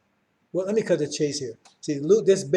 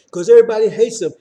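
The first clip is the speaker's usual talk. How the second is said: higher, loud shout of everybody; preaching shout; 4 semitones higher, 11 dB louder than usual. Real, not acted. Preacher to congregation about enemies, loud preaching shout. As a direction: throw it out over the congregation.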